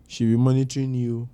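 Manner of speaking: ordinary talk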